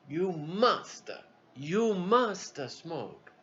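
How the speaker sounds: put-on slow, halting Italian-accented English; Italian accent, drawn out; Italian captain accent. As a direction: insist slowly in broken Italian English. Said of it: "Italian, you know, American broken English".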